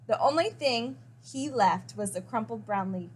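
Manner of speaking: ordinary delivery, nothing marked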